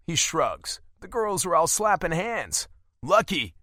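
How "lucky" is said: calling over. Acted. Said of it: "I call over to her".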